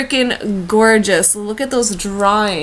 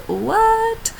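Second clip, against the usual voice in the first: mock surprise; 14 semitones higher than usual. Mock surprise at own trip.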